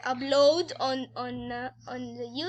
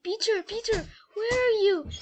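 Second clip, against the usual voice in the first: higher-pitched searching call; anxious calling; 8 semitones higher, 5 dB louder than usual. A narrator's reading. Scared sister searching for Peter, anxious calling. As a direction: call out for someone missing. Sister calls for missing brother.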